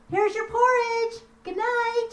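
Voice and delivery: put-on high, light girl calling good night; high innocent daughter voice; sweet girl's voice. Daughter voiced leaving porridge.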